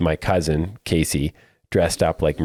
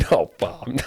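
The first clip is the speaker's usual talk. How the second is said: laughing; 11 semitones higher than usual. Real, not acted. Laughing at the joke.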